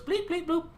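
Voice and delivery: high electronic beeping; droid beeps. Acted. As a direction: imitate a little droid's beeps. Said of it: "B T goes".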